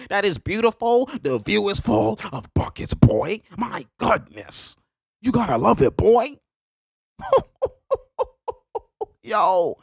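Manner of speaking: high-pitched, drawn-out raving; thrilled admiration. Real, not acted. Celebrating the step back for game, high thrilled raving. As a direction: rave about the shot up high, stretching words.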